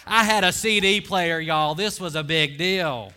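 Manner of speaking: loud proud laughing boast; excited brag. Real, not acted. Preacher telling church about his CD player, loud proud brag. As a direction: sell it like a treasure.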